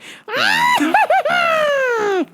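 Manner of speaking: long high shrieking cackle; manic cackle. Retold or imitated. She comes in the store, manic high cackle. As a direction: mimic a crazed, shrieking laugh. Imitates her behind girl's back.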